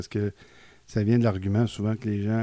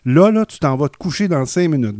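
higher, loud parent ordering bedtime; imitating parent; 5 semitones higher, 10 dB louder than usual. Parent voiced telling child to go to bed, loud and high. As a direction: play the parent sending the kid to bed.

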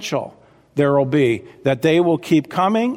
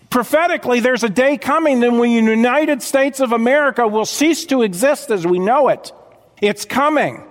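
fiery preaching; 6 semitones higher than usual. Acted. Pastor warning the congregation about judgment, fiery preaching. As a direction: warn the flock with rising fire.

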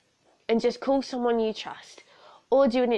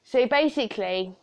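ordinary talk, nothing marked

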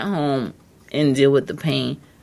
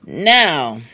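usual speaking voice

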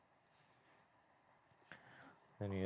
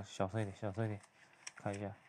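hushed aside; 2 semitones lower, 12 dB quieter, faster than usual. Real, not acted. Narrator hushing himself while watching, hushed. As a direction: drop to a near whisper. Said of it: "悄悄话".

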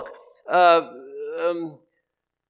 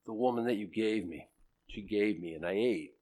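much softer, the woman that you gave me said low and owning it; quiet confession; 4 semitones lower, 11 dB quieter than usual. Retold or imitated. Adam owning his sin to God, soft and humble. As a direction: confess it softly, no blame. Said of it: "Different emphasis".

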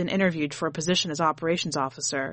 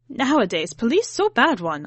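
louder, aggrieved grandma grumble; complaining; 5 semitones higher, 4 dB louder than usual. A narrator's reading. Grandmother grumbling at the table about bad police. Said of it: "Ahma complains".

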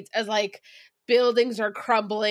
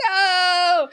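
mock panicked no; 9 semitones higher, 5 dB louder than usual. Panicked people reacting to virus.

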